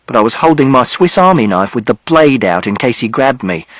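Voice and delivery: ordinary delivery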